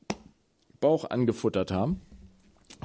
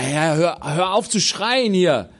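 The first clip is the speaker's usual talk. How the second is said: annoyed crowd; 4 semitones higher, 5 dB louder, slower than usual. Acted. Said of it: "die Leute haben gesagt".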